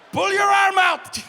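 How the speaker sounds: high, very loud yell; acted full-force bellow; straining shout. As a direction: shout it as you yank hard. Acts himself yelling while pulling strap.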